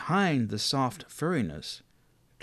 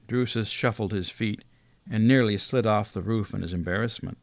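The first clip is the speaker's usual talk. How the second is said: plain reading voice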